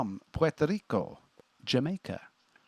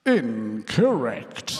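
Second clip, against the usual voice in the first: mock robot verdict; 7 dB louder, much slower than usual.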